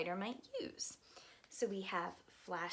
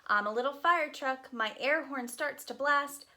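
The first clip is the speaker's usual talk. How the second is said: lively, sing-song picture book reading; reading to children; 6 semitones higher, 4 dB louder than usual. Acted. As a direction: read it aloud to a group of little kids.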